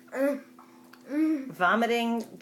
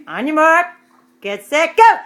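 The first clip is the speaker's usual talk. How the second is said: loud race start; 8 semitones higher, 12 dB louder than usual. Real, not acted.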